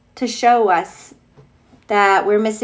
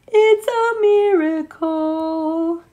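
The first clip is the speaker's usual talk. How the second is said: drawn-out, slow wonder; slow marvel; 7 semitones higher, much slower than usual. Real, not acted. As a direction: savour each word in slow amazement. Delighted reaction to the picture.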